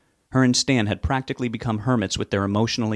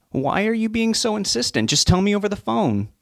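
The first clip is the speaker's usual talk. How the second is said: frustrated; 11 semitones higher than usual. Acted. Son frustrated.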